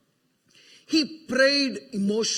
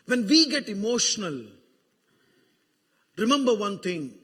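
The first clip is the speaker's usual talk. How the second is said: low, intimate pastoral hush; hushed tender preaching; 6 semitones lower, 5 dB quieter than usual. Acted. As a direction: speak it gently, almost to yourself. Soft reflective stretch of sermon.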